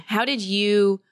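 ordinary delivery

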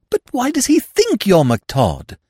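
high, loud bewildered question; high-voiced puzzled young fool; baffled Freddy. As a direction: insist in high-pitched confusion.

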